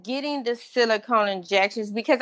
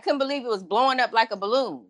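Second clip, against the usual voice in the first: usual speaking voice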